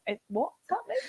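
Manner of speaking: confused mum. Retold or imitated. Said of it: "And she's like".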